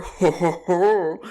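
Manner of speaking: loud hearty ho ho ho laugh; belly-laugh ho ho ho; jolly booming laugh. Acted. Jolly laugh closing goblin's line.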